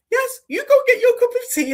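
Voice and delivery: very high, loud put-on voice with laughter; chirpy AI reply. AI sending the speaker off for tea, high laughing mock voice. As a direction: squeal it out as a mock-encouraging AI.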